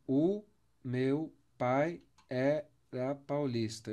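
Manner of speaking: meu pai era paulista drawn out slowly, syllable by syllable; slow syllable count. Shown as a demonstration. Teacher counting syllables for students, slow and spaced. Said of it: "Vamos contar quantas sílabas tem?".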